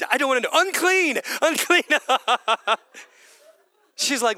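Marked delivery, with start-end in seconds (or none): 0.5-1.8 high, loud call of "unclean"; outcast's cry. Retold or imitated. Preacher voicing an outcast calling out, high loud cry. 1.9-2.8 real laugh at own joke; short laugh. Real, not acted. Preacher laughing at his own joke, real laugh.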